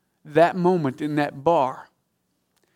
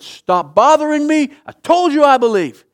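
angry shout; 11 semitones higher, 10 dB louder than usual. Retold or imitated. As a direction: shout him off, done with the questions. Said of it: "the guy got mad at him".

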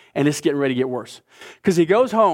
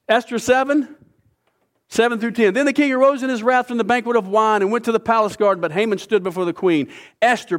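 raised, declaiming reading voice; scripture reading; 5 semitones higher than usual. Acted. A preacher reads Esther seven to the congregation, declaiming. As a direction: read the passage out to the congregation.

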